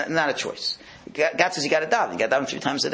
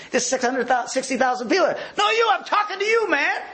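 insistent announcer shout; 12 semitones higher than usual. Retold or imitated. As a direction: insist over the PA it's really him. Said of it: "the guy doing the public announcing system".

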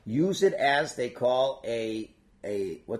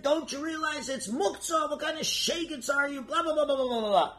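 high-pitched imitation of a scolding father; mock yelling; 13 semitones higher than usual. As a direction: pretend to yell at a kid, pitched way up without extra volume. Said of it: "He starts yelling at the kid".

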